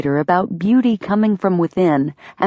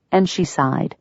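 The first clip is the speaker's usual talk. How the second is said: ordinary delivery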